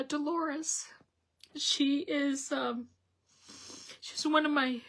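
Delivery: high, tearful voice on the edge of crying; choked up. Real, not acted. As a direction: fight back tears as you dedicate it. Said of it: "why I'm getting emotional".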